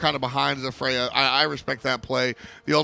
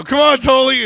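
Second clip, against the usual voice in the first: loud, high begging call; pleading shout; 10 semitones higher, 13 dB louder than usual. Retold or imitated. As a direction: beg him, loudly. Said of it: "And I go".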